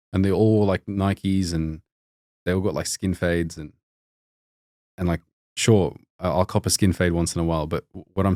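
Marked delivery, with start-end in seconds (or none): none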